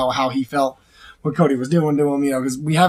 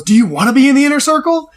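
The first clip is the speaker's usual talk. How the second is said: lightly voiced Jericho, raised high and louder; mock [name]; 9 semitones higher, 5 dB louder than usual. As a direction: tease him like a smug Jericho. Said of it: "And [name] goes".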